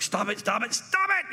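hammed-up sibling whine; annoyed little sister. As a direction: imitate a sister pestered in the back seat. Sister provoked in back seat.

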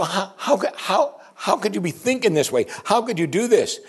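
high, stammering incredulity; frightened disbelief. Acted. Frightened men confront Jonah about fleeing God, high stammering disbelief. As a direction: stumble over the question in acted shock. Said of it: "the men became extremely frightened".

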